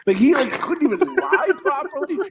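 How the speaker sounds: high, amused disbelief through laughter; laughing. Real, not acted. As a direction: laugh as you say it, pitch climbing. Laughs at kid's bad lie.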